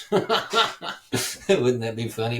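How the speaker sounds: cracking up at his own joke; laughing. Real, not acted.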